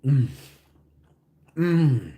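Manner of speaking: ordinary delivery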